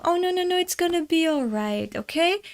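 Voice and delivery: put-on high, soft coaxing as to a child; soothing, not panicking. Adult calming a crying boy who slipped, soothing high voice. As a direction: reassure gently, pitched well up. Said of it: "to not panic the boy".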